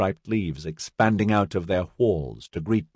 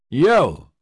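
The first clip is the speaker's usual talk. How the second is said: loud higher barked call; bellow; 7 semitones higher, 5 dB louder than usual. A narrator's reading. Bellowed loud across the bridge. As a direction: sergeant bellowing at the gang, loud. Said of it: "Che bellowed".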